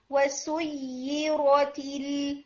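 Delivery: وسیرت with the doubled ya held long; teacher showing shadda length; slow shadda demo. Shown as a demonstration. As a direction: hold the ya in وسیرت. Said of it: "یا کا time آپ کا کم تھا".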